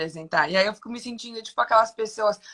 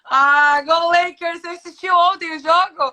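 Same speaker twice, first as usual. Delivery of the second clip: excited cheer; 9 semitones higher, 7 dB louder than usual. Real, not acted. Guest cheers wearing Lakers gear.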